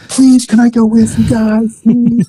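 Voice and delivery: high, eager begging; pleading kid brother. Retold or imitated. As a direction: beg like a little brother.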